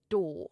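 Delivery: "do" as "daw", door without its R; non-rhotic RP. Shown as a demonstration. Teacher showing RP door, "do" as a long "daw". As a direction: drop the R of door and lengthen the O in "do". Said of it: "You drop the R and you elongate the O".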